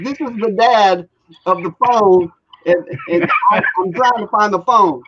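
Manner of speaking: mock frantic. Frantic dad calling lost phone.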